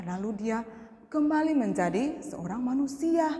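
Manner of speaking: ordinary talk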